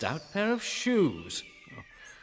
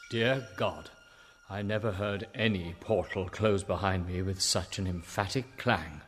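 ordinary delivery

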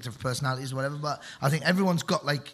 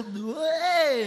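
high excited whoop; 11 semitones higher, 3 dB louder than usual. Retold or imitated. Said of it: "And you go".

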